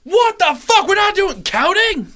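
high-pitched mock German outburst; shouted German accent. Riffing on the German bit, high shouted German accent. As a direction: yell it in an exasperated German accent. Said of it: "very German".

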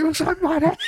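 high-pitched mock scream through laughter; Keanu Reeves. Friend quotes the movie's last line, high mock scream. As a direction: wail it in helpless outrage, half laughing. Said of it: "Keanu Reeves screaming".

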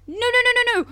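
normal talking voice

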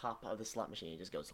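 normal talking voice

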